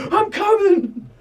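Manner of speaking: high, loud mock battle cry; playful high-pitched yell; calling out. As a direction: holler it as you charge in. Acted calling out while running in.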